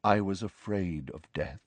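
hushed, grave confession; soft-voiced admission. A narrator's reading. Ghost confesses to Harry, softly. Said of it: "said Nick softly".

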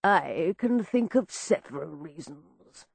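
low, slow, pompous voice; pompous man. A narrator's reading. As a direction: speak down to the room pompously. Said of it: "spake a pompous male voice".

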